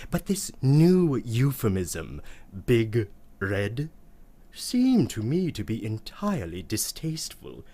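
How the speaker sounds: usual speaking voice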